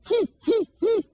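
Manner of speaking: very high, loud whinny; high loud horse whinny. Shown as a demonstration. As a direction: neigh like a horse. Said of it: "Can you say that".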